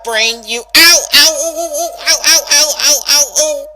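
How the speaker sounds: loud, rising yelps of pain; pained ow cries. Acted. Rainbow cut off mid-insult by tooth pain, yelping. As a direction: break off into a string of ows.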